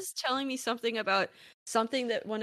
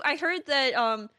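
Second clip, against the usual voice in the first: ordinary talk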